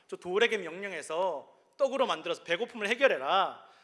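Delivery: high, loud mocking tempter building to a cry; taunting devil. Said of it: "마귀가 또 속삭입니다".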